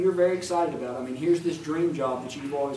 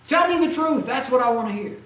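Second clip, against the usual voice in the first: much higher, louder demanding king; 6 semitones higher, 6 dB louder than usual. Acted. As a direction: insist like an impatient king.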